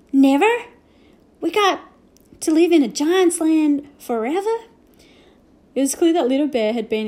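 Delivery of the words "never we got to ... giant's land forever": higher, louder alarmed cowboy; a cowboy horrified at staying; dismayed Boone. A narrator's reading. Boone asks if they must stay forever, alarmed.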